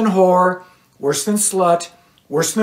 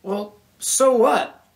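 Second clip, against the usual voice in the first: exclaimed angrily; 6 semitones higher, slower than usual. A narrator's reading. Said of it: "Doug exclaimed angrily".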